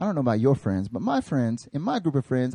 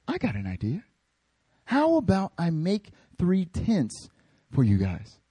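softer, slow, playful offer; dopey bright idea; 4 dB quieter than usual. Retold or imitated. Peter offering tents, slow goofy idea. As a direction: pitch it like a silly plan. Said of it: "he says".

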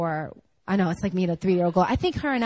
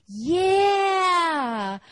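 imitating child's excited yeah; 8 semitones higher than usual. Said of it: "She's like".

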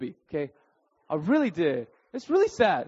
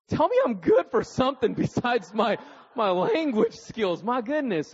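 raised-pitch, drawn-out comic protest; mock indignation; 6 semitones higher than usual. Golfer answers his teammate's backhanded praise, in mock indignation. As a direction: protest with wounded pride, hammed up.

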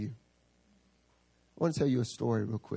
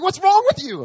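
much higher, much louder, laughing outburst; freaking out; 12 dB louder than usual. Retold or imitated. Zach flipping out at the pastor, loud high outburst. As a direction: lose it in shrill comic disbelief. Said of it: "It's like".

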